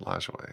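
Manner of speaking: "Lajoie" said LAHZH-way, low and soft; corrected pronunciation. Shown as a demonstration. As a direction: echo "Lajoie" quietly with a zh and "way". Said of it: "There you go".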